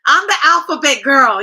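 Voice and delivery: loud, bright self-introduction; mock announcement. Mocking a spy who loudly announces her secret. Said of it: "They're not gonna announce to you".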